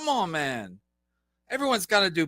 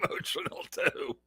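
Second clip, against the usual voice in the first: mocking laugh; 10 dB quieter than usual. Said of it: "This guy".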